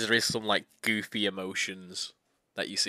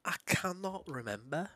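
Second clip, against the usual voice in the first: put-on lost boy; 11 semitones higher than usual. Reads the amnesiac boy's lines.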